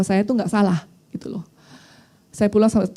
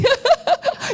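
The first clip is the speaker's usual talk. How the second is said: high, helpless burst of laughter; real laugh. Real, not acted. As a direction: crack up at yourself. Laughs at own hot temper. (tawa lepas bernada tinggi)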